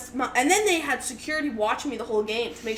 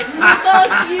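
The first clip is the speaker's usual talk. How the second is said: louder, higher comic outrage; mock angry shout; 5 semitones higher, 11 dB louder than usual. Teen seeing sister drinking beers in the background, mock furious. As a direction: shout it as an exasperated sibling.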